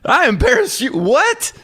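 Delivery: incredulous dad. Retold or imitated. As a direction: throw it back in total disbelief. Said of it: "I'm like".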